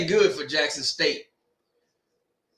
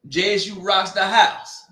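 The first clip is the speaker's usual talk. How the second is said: normal talking voice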